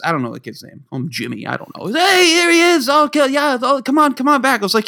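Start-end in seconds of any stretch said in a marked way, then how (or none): 1.9-4.6 jolly neighbor dad. Retold or imitated.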